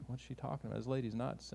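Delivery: low, hushed muttering to each other; hushed aside. Retold or imitated. Boxcar passengers dismissing the screaming lady, hushed. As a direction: say it under your breath, puzzled.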